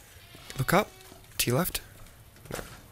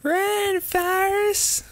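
very high-pitched urging cry; playful falsetto shout to run; high mock call; 3 dB louder, much slower than usual. Calls out to the game character.

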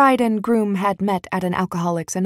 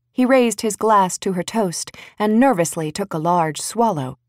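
usual speaking voice

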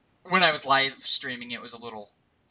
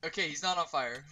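usual speaking voice

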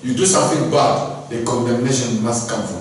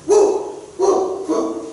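high yelping woo barks, much higher; dog barking; much slower than usual. A narrator's reading. Preacher barks like the dog in the story, high yelps. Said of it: "This dog start again".

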